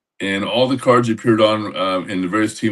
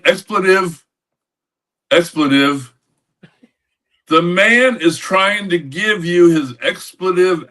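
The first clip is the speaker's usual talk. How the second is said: slow, higher drawling ballplayer voice, words stretched out; a raised, slow, put-on drawl chewing out the clubhouse visitor; Will Clark's drawl; 8 semitones higher, slower than usual. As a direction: drag every word out lazily, pitched up, exasperated. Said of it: "with his drawl".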